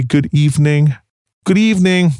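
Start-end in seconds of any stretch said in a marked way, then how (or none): 1.4-2.1 pitched up, mocking the demand. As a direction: sing it back with a sneer. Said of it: "Shonda said mockingly".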